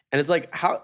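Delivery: ordinary talk, nothing marked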